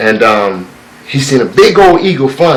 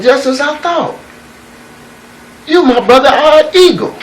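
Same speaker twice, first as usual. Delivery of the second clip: put-on old eagle proclaiming; 10 semitones higher than usual. Old eagle declaring to youngster.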